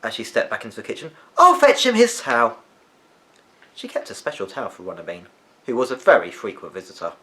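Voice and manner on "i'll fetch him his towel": cheerful grandma. A narrator's reading. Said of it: "said Maisie cheerfully".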